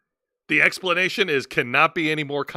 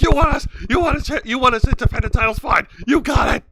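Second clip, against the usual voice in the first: high, loud, hurried imitation of yelling; mock panicked yelling; 8 semitones higher, 7 dB louder than usual. Host imitating a wrestler cutting off a rival, panicked yelling. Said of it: "like, just yelling shit".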